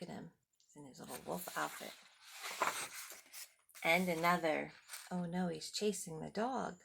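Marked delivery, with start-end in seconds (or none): none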